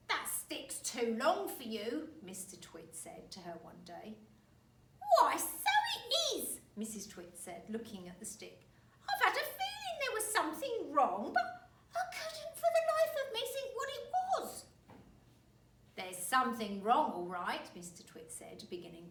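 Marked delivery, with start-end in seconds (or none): 0.1-2.1 put-on slow, loud, teasing old man; sly husband. Husband goads wife. 5.2-6.6 put-on high, loud old woman; old wife voice. 9.1-14.6 put-on high, loud old woman; old wife voice. 16.0-17.7 put-on loud, knowing old man; sly husband. Husband voiced in story.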